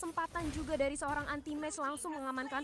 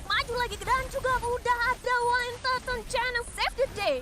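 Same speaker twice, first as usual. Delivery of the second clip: excited; 6 semitones higher, 7 dB louder than usual. Real, not acted. Caster hypes a teamfight save.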